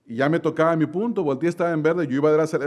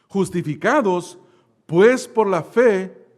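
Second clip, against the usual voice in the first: each word slowed right down and pushed louder; slow emphatic reading; 4 semitones higher, 6 dB louder, slower than usual. Shown as a demonstration. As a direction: lay the verse out word by word. Reads the verse aloud to congregation. (lectura lenta y enfática)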